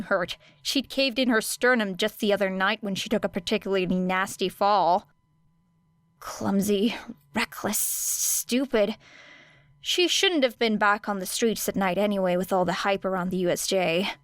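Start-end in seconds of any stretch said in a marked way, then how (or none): 6.2-9.0 slow whispered self-reproach; slow bitter whisper; self-scolding whisper. Acted. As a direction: hiss it at yourself, slowly.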